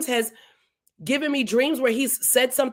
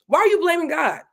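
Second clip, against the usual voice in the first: higher-pitched acted rebuke; indignant challenge; 5 semitones higher than usual. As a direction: confront him as Elihu would, in mock reproach. Acts out Elihu challenging Job.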